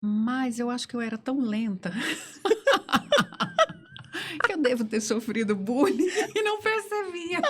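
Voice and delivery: talking through giggles; high giggly laughing telling. Real, not acted. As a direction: laugh while confessing. Laughing while telling of own slowness.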